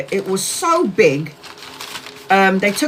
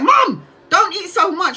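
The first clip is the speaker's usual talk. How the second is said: higher, slow, drawn-out warning; pleading warning; 10 semitones higher, 4 dB louder than usual. Retold or imitated. Warning her mum off the weed cakes, high and slow. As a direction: stretch it out, begging her to stop. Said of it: "I was like".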